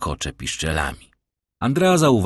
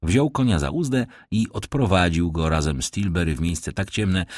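ordinary talk

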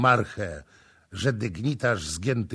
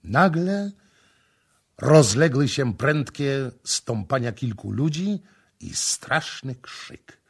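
plain reading voice

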